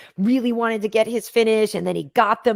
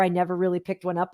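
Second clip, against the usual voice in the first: ordinary delivery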